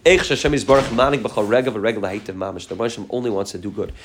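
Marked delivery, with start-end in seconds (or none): 2.7-3.9 softer, inward repeat of the teaching; a soft, inward affirmation. Shown as a demonstration. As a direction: say it again quietly to yourself.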